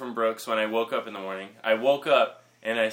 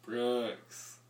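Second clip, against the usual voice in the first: softer, drawn-out name; toned-down name; 7 dB quieter than usual. Real, not acted. Guest told not to yell, saying his name softly. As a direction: drop the volume after yelling. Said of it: "Don't yell".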